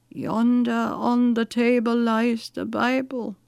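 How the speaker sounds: slow, weak invalid's voice; sick woman. A narrator's reading. Sick widow pointing her servant to the Bible, slow and weak. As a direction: draw it out feebly from the sickbed. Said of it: "said the sick woman to Sarah".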